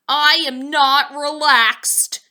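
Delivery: slow, loud alarmed cry; cried out. A narrator's reading. Poppleton crying out on the bouncing waves, slow and loud. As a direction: wail it in panic. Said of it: "cried Poppleton".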